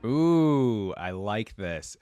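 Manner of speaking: ordinary delivery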